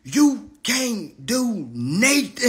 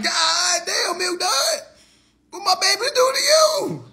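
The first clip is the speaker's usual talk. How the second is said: exasperated yell; 9 semitones higher, slower than usual. Retold or imitated. Ranting at Milk Dud over his kids, high outraged yell. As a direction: wail it out, outraged. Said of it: "I'm like".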